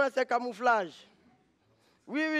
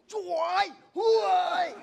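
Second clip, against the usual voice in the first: shout; 5 semitones higher, 3 dB louder, much slower than usual. Retold or imitated. Angry Chinese man in story.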